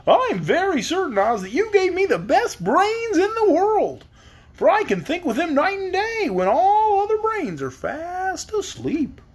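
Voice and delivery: high, slow straw man voice; cheery high Scarecrow. Acted. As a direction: pitch it way up like a stuffed scarecrow. Scarecrow keeps talking.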